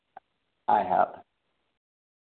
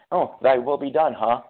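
louder, higher needling jab; teasing friends; 4 semitones higher, 7 dB louder than usual. Retold or imitated. Friends calling out his wacky behavior, loud teasing jab. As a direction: poke at him knowingly. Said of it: "my friends are quick to call me on my B S".

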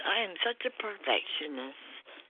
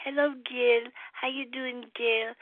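a light Trinidadian lilt, pitched up and singing; Trinidad sing-song; 7 semitones higher than usual. Retold or imitated. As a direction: greet him in an easy Trinidad sing-song. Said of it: "the sing-song".

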